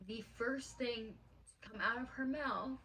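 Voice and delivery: high, choked-up real emotion; holding back tears. Real, not acted. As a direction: fight back tears while telling it. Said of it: "I don't wanna cry in this video".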